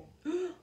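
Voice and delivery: quick sharp in-breath; shocked gasp. Acted. Reader gasping as Maria runs to the kitchen, sharp in-breath. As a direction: gasp in alarm.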